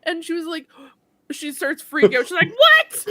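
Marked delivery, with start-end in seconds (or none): 2.5-3.1 high, loud shrieked "what"; shocked yell. Retold or imitated. Friend finding out she missed more, shrieking in shock. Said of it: "And starts yelling at me".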